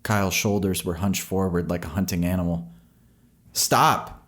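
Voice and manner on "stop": sharp high cry of stop. A narrator's reading. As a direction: call out to stop the dog. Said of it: "he cried out".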